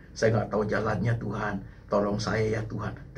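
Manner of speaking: low, soft voice speaking to God; intimate pleading prayer. Retold or imitated. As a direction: plead quietly and privately in prayer. Re-enacts his own prayer to God.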